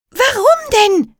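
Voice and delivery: put-on high, loud girl's yell; shouting. A narrator's reading. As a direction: yell back at him. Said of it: "brüllte Emma zurück, so laut".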